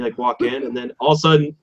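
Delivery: normal talking voice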